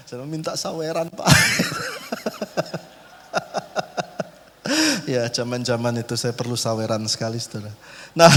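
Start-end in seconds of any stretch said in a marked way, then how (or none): 1.3-2.8 a sudden high-pitched burst of laughter. Real, not acted. Preacher laughs high at his own joke about tips.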